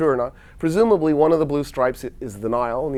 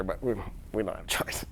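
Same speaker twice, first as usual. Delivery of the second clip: hushed, breathy admission; mock sheepish whisper; whispered aside; 7 semitones lower, 13 dB quieter than usual. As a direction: whisper it like a guilty confession. Germany confessing quietly to Egypt.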